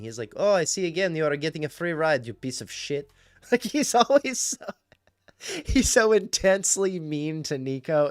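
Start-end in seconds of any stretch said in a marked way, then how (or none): 0.3-3.1 higher put-on taxi driver, sinking at the end; Muhammad. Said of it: "Muhammad, picks you up and he's like". 3.1-8.1 high, loud, laughing through the words; can barely get it out for laughing. Real, not acted. Cracking up about the character's meanness.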